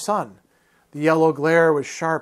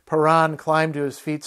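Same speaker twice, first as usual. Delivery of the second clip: plain reading voice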